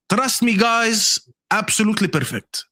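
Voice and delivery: slow, high-pitched accent impression of Mo. Host mimicking Mo to plug jerseys, Mo accent. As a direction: read the promo in a put-on Mo accent. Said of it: "do it in the Mo accent".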